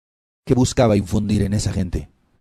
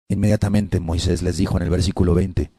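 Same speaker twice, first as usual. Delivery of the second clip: normal talking voice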